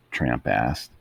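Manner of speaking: ordinary delivery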